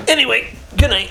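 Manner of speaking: high cooing good night; pillow talk. Real, not acted. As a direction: coo a last good night. Final good night to baby.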